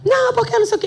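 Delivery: high mock yell, blustering; judge yelling. Judge protesting as lawyer leaves, high mock shouting. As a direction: imitate a shouting judge ranting on. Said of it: "E ele gritando".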